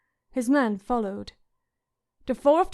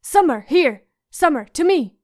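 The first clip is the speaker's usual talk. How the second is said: loud, higher call to the wolf; boy calling his wolf; 4 semitones higher, 9 dB louder than usual. Acted. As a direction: call the dog back urgently.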